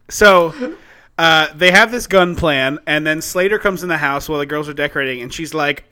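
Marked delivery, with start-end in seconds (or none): none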